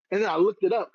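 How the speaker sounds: usual speaking voice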